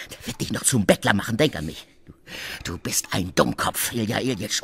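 ordinary talk

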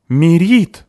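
loud, high exasperated call; shouting at someone. A narrator's reading. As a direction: yell the name to stop her. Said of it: "закричала на неё Криспи". (крик, на высокой ноте)

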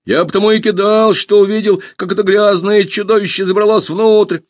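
high, loud, heated explanation; worked-up self-justification; excited boy. A narrator's reading. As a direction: protest eagerly in a boy's high voice. Pashka excitedly justifies throwing stones.

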